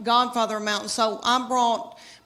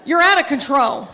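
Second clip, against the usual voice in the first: mock yelling at a teenage son to turn it down; 3 semitones higher than usual. Mom hollering at her boy to turn the music down, mock yell. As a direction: scold like an exasperated mom, half joking. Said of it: "yell at my boy".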